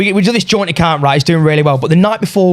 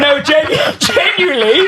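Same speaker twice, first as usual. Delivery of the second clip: high-pitched, laughing protest; laughing insistence; 9 semitones higher, slower than usual. Real, not acted. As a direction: insist through real giggles. Insisting while cracking up.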